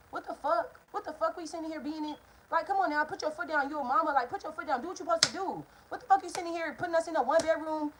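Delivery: worked-up complaint, rising in pitch; exasperated rant. Retold or imitated. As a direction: plead and scold in disbelief. Rants at mother over small apartment.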